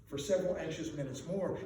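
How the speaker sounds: ordinary delivery, nothing marked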